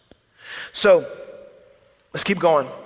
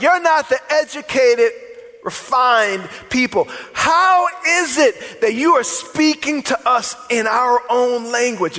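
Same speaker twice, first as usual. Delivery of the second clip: acted disbelief; 4 semitones higher than usual. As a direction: play a looked-down-on challenge, raised pitch. Acts out onlookers' disbelief at Galileans.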